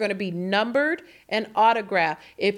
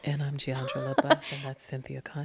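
soft, hushed ASMR intro; ASMR whisper; 10 semitones lower, 9 dB quieter than usual. Shown as a demonstration. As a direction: breathe the names close to the mic. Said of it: "we're gonna do A S M R versions".

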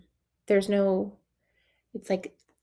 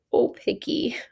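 whispered aside; 4 semitones higher, slower than usual. Real, not acted. Complaining about picky client.

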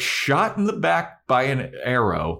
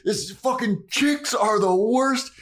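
a hint of a furious yell, pitched up; imitating a ranting vampire; lightly hammed-up outrage; mock angry rant; 12 semitones higher than usual. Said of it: "he just starts fucking screaming and he is pissed".

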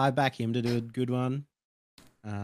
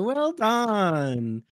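drawn-out, higher-pitched mock praise; indulgent applause; 8 semitones higher than usual. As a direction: humour them with a patronising cheer. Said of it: "we just clap along and go".

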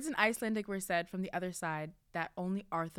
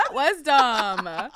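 slow loud repeat of that; loud drawn-out; 4 semitones higher, 14 dB louder, much slower than usual. Pretend. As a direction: stretch out that, loud.

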